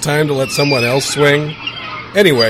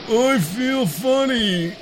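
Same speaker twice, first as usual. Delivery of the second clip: coughing; 7 semitones higher, much slower than usual. Acted. Breathing radioactive cloud.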